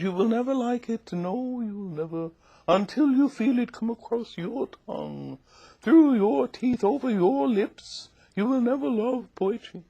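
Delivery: put-on high, plummy old Southern lady; Missus Flowers. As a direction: lecture a child in a high, ladylike voice. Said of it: "She said".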